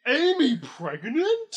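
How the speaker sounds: high, loud, slow mock promo; mock announcer. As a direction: stretch it out like a cheesy ad. Mocking the ad.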